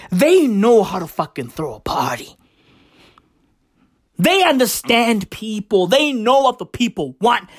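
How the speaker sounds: usual speaking voice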